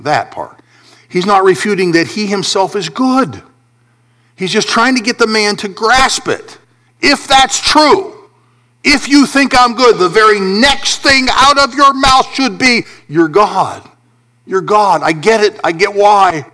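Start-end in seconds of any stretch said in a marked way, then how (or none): 1.1-12.8 high, forceful sermon delivery; exasperated preacher in full cry; impassioned preaching. Real, not acted. 13.1-16.4 raised, eager exclamation; mildly hammed-up moment of understanding; acted realization. Acts the reply the man owed.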